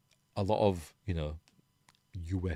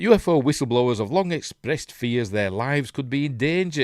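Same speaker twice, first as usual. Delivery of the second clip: crisp headline read, louder; news read; 5 semitones higher, 7 dB louder than usual. Acted. Reading article aloud.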